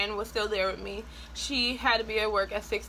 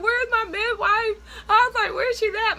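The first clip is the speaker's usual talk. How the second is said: high, loud, panicky pleading; freaking out; 12 semitones higher, 5 dB louder than usual. Retold or imitated. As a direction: cry out in alarm for the midwife. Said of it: "I'm freaking out".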